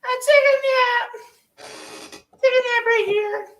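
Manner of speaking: sleepy little creature voice; Grogu voice. Pretend. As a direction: coo it like a tiny alien. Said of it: "and then goes".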